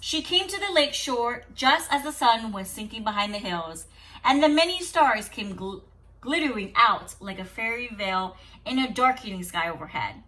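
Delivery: usual speaking voice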